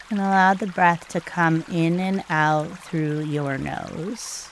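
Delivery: soft, hushed meditation guide with long pauses; calm, unhurried breathing instructions, spoken softly; guided meditation. Real, not acted.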